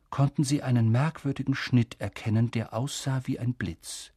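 plain reading voice

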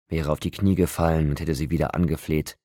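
plain reading voice

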